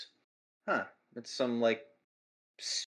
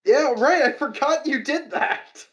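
surprised; 13 semitones higher, 8 dB louder than usual. Real, not acted. Sudden recall, much higher.

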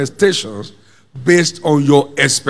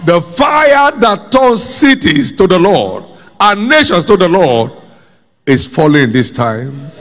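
forceful preaching; 3 semitones higher, 3 dB louder than usual. Real, not acted.

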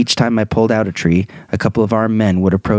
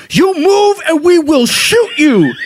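mock guard threat; 4 dB louder, slower than usual. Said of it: "they're like".

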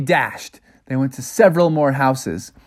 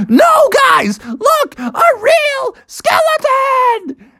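high shout, drawn out; panicked warning; much slower than usual. Acted. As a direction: warn them in a shrieking voice. Bubby warns the other kids.

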